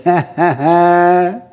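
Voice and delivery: forced "ha, ha, haaa" with long last note; deliberate laughing. Shown as a demonstration. Preacher answering the devil, deliberate drawn-out laugh. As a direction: put on a defiant practice laugh. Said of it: "start laughing like that".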